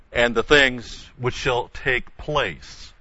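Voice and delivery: "and the things which shall take place" drawn out slowly; measured, slow scripture reading. Shown as a demonstration.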